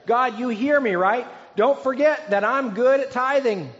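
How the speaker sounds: high, loud, showy prayer for onlookers; self-righteous Pharisee. Acted. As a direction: pray to be overheard, smug and pushy. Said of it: "Can you hear that tone in his voice?".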